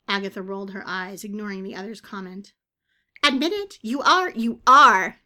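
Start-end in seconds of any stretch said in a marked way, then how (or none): 3.2-3.8 high, loud jeering demand; needling shout. A narrator's reading. Bird needles the witch. 3.8-4.3 rising high and loud you are; jeering repeat. A narrator's reading. Bird needles the witch. 4.3-5.2 you are pushed much louder, falling; louder jeering repeat. A narrator's reading. Bird needles the witch.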